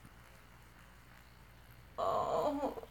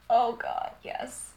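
ordinary talk, nothing marked

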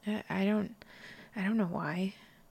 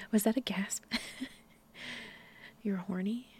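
ordinary talk, nothing marked

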